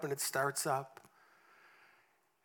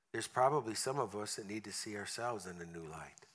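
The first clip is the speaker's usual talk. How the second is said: prayer; 5 semitones lower, 5 dB quieter than usual. Acted. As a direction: pray quietly over the congregation. Closing prayer.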